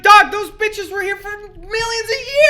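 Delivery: high, slow excited quote; hyped up disbelief. Retold or imitated. Excited quote about dinosaurs.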